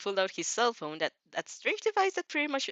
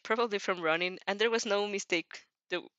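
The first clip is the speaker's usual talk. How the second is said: plain reading voice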